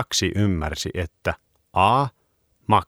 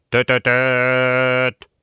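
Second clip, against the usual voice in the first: truck horn; 5 semitones higher, 4 dB louder, slower than usual. Acted.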